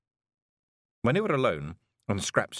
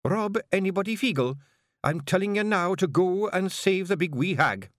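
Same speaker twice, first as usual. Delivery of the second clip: raised, sharp command; cold Kelder voice; 12 semitones higher, 4 dB louder than usual. Acted. Jeanie orders Rob to save the hag, in a sharp cold Kelder voice. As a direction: cut like ice. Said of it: "cold voice of a Kelder".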